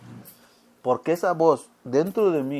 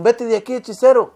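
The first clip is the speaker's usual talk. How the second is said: loud, higher mock yell; angry shout; 6 semitones higher, 9 dB louder than usual. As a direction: drive him off with a shout. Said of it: "le dijeron".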